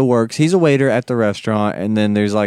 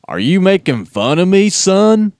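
much higher, louder indignant Texan; offended Texan; 8 semitones higher, 5 dB louder, slower than usual. Retold or imitated. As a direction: bristle at being mocked. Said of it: "He's like".

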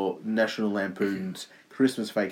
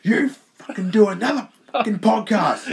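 very high, louder hammed-up commentator voice; mock commentator rant; 11 semitones higher, 5 dB louder than usual. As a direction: bark it like a cartoon tough guy. Voicing the commentator's demand.

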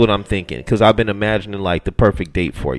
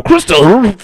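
Christopher Bailey sung out high and loud, like an announcer; mock announcer; 11 semitones higher, 7 dB louder, slower than usual. Shown as a demonstration. Host joking he rolls no Rs, mock announcer for Bailey. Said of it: "I have to go".

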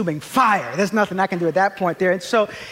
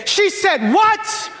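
hammed-up, high-pitched indignant shout; disproportionate outrage; 12 semitones higher, 4 dB louder, slower than usual. Husband interrupting to side with his wife, absurdly outraged. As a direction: shriek it in over-the-top mock fury, drawing words out. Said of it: "in a disproportionate kind of a way".

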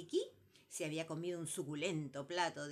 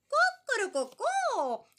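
loud, very high slow cocorococó cackle; hen cackle; 12 dB louder, much slower than usual. Acted. Hen cackling at number three's wedding news.

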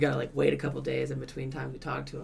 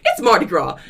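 loud, raised mock protest; indignant shout; 4 semitones higher, 11 dB louder than usual. Reveler to debt collector, loud indignant shouting. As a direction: shout it as an obvious excuse. Caller shouts his excuse.

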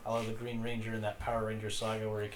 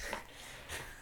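whirring robotic drone sounds instead of words; breathy mechanical drone whir; drone noises; 11 dB quieter than usual. Pretend. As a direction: imitate a small drone buzzing. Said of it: "why are you speaking like Droney?".

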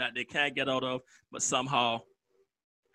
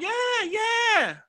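much higher, excited; a buddy's overexcited yes; eager yeah. Retold or imitated. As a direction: sell it with a burst. Buddy pitches anime eagerly.

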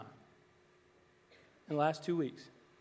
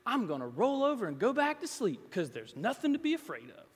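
put-on child's answer; 7 semitones higher than usual.

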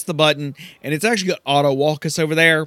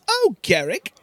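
haughty Silky voice; 10 semitones higher, faster than usual. Acted. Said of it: "So Silky says".

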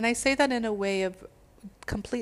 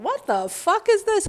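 incredulous; 13 semitones higher, 7 dB louder than usual. Retold or imitated. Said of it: "you're like".

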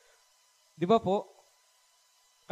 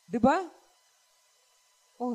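normal talking voice

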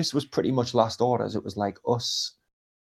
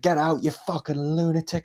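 mild barmaid yell; 5 semitones higher than usual. Retold or imitated. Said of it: "this lass behind the bar".

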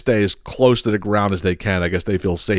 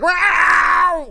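high imitated cat yowl. Freaked-out cat answering its owner, high imitated yowl. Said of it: "And he was like".

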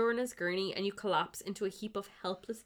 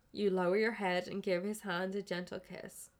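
ordinary delivery

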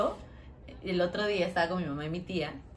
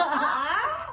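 high mock gasp, laughing; 12 semitones higher than usual. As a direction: squeak it in surprise. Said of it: "Y yo". (exclamación aguda burlona)

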